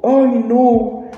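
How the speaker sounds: high, slow, whiny fake sobbing; mocking crybaby whine. Bully tattling to the principal, mock whiny crying. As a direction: ham up the bully's tattling whimper. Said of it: "lloró como una perra desconsolada".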